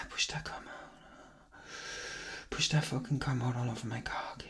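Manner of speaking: soft murmur. Real, not acted.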